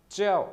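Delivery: च्याओ said high and loud, dropping sharply; falling fourth tone. Shown as a demonstration. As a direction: snap च्याओ down from a high start. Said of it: "fourth tone है च्याओ".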